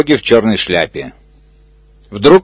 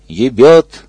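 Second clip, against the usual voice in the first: ordinary delivery, nothing marked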